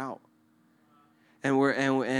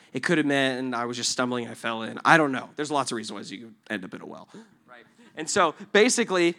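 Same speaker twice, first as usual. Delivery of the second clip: ordinary talk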